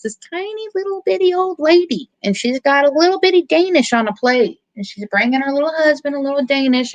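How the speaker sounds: high-pitched cooing over someone tiny; tiny-old-lady cutesy voice. Retold or imitated. Storyteller describes a tiny old lady with her Danish, high cutesy voice. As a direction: squeeze it up high, cutesy and little.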